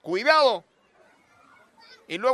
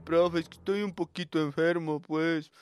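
meek student excuse; 10 semitones lower, 10 dB quieter than usual. Retold or imitated.

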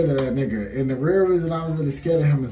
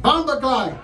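lightly voiced Jamaican exclamation, pitched higher; a quick Jamaican curse thrown in for fun; Jamaican accent; 9 semitones higher than usual. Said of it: "y'all like my Jamaican accent".

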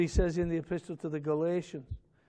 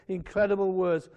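ordinary talk, nothing marked

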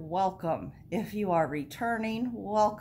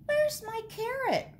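very high-pitched little rabbit voice; questioning rabbit. A narrator's reading. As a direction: ask it high, as a story-time rabbit. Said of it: "Rabbit asked".

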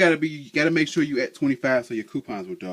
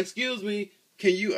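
much higher, sugary polite voice asking a favour; overly polite ask; 10 semitones higher than usual. Retold or imitated. Asking passing girls to read the sign, high sweet politeness. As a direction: put on your sweetest asking-a-stranger voice.

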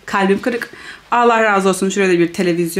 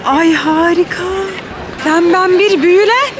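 high, louder, enchanted; awed; 8 semitones higher, 3 dB louder than usual. Real, not acted. Awed gushing at the golden arcade. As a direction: gush over the beauty.

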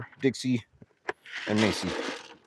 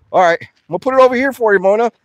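nervous placating; 9 semitones higher, 7 dB louder, faster than usual. Real, not acted. Nervously placating the crowding cow.

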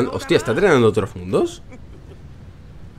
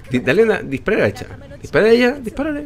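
much higher, laughing shouts; frantic urging; 11 semitones higher than usual. Real, not acted. Gamer urging an ally to shoot her, high and laughing.

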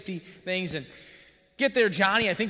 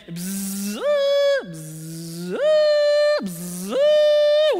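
high shrieking wail with buzzing noises, much louder; hammed-up child shriek with buzz sound effects; imitated child wail; 10 dB louder, much slower than usual. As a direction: mimic a kid screaming down a zip line. Imitates son wailing down zipline.

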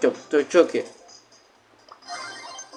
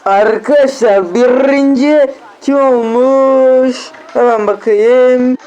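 slow, loud, drawn-out suspense; excited; 4 semitones higher, 5 dB louder, much slower than usual. Real, not acted. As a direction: stretch it out like a big reveal, louder. Sonucu merakla bekliyor. (heyecanla, uzatarak duyuru)

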